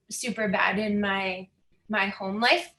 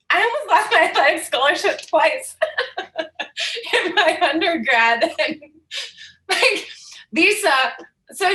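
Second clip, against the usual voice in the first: words bubbling through laughter, higher and louder; laughing while talking; 8 semitones higher, 5 dB louder than usual. Real, not acted. Admitting to interviewer she was the worst student, laughing. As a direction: laugh your way through the confession. Laughing at own bad grades.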